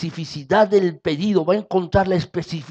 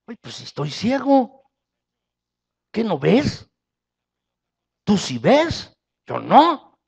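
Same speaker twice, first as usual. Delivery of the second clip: sassy retort; 5 semitones higher, 3 dB louder, slower than usual. Acted. Blind man retorts to Jesus.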